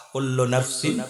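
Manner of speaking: low, measured Arabic recitation; Quranic recitation. Real, not acted. Preacher recites the verse on death to congregation, low solemn recitation. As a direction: recite solemnly with drawn-out vowels.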